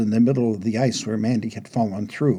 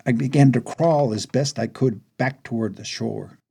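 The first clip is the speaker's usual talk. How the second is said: usual speaking voice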